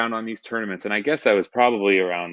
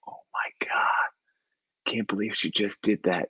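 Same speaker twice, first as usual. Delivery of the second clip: slow, voiceless whisper of shock; hushed, acted-out disbelief; awed mock whisper; 6 dB quieter, slower than usual. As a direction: whisper it like a stunned kid in the back seat. Stunned boys whispering in back seat.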